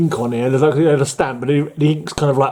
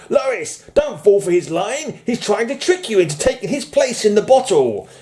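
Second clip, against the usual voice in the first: high, hammed-up comic-book warning; urgent warning; 9 semitones higher than usual. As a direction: warn Lois in a put-on higher voice. Reads cover warning shouted to Lois.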